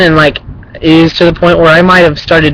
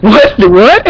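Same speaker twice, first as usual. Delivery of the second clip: higher-pitched mock double-take; startled mimicked reaction; 6 semitones higher, 3 dB louder than usual. Bullies startled out of nowhere, mock surprised questions. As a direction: act caught off guard from behind.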